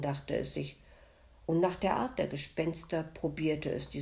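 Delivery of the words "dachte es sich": ordinary delivery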